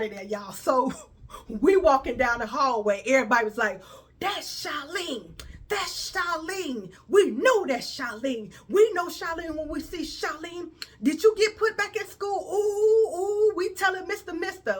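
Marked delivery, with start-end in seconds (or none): 4.2-12.5 high, drawn-out mocking schoolkid chant, rising into a yell; teasing kids. Kids in the hallway spot Charlene and taunt her, slow loud mocking. 12.5-14.8 laughing tattletale. Real, not acted. Kids threatening to tell.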